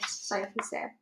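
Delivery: plain reading voice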